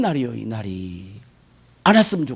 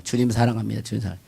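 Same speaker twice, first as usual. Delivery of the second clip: softer, trailing-off mock devotion; softer and lower, drifting off mid-word; fading devotion; 12 semitones lower, 8 dB quieter, faster than usual. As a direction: let the praise fade into a mumble. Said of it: "그렇게 다녀요".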